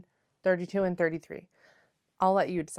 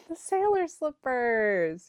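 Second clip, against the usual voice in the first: title announcement; 6 semitones higher, slower than usual. Real, not acted.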